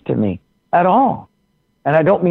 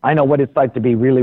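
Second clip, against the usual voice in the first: usual speaking voice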